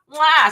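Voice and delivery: loud smacking air kiss; blown kiss. Real, not acted. Blowing a loud kiss to a viewer in Belgium.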